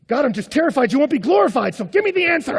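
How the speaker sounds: high, loud, hammed-up pleading; frantic mock prayer at full volume; mock fervent prayer. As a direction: beg God in exaggerated desperation. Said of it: "I'll start praying fervently".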